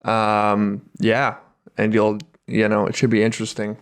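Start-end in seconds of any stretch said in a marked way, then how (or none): none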